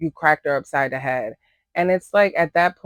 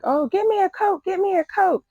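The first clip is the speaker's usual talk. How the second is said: very high-pitched fussing voice; hammed-up high squeal of concern; imitated consoling. Friend fussing over E T while consoling her, imitated high voice. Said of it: "consoling E T and like".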